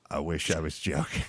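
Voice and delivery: a touch of fake regret; mock-grave doctor. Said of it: "And he's like".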